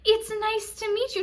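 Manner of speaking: high-pitched imitation of the greeter; over-happy greeter. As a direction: gush it high, mocking the greeter. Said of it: "she's like".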